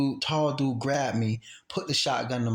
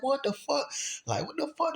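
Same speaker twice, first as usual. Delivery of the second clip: very high-pitched retold shock; imitated incredulous yelp, light in volume; shocked disbelief; 3 dB quieter than usual. Acting own shock at the threat.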